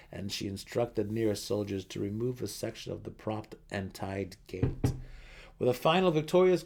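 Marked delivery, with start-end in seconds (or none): none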